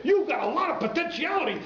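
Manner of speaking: high, loud, gushing cabbie voice; excited taxi driver. Retold or imitated. Taxi driver praising the boys, high and loud. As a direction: shout the praise like an overjoyed stranger. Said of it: "he says".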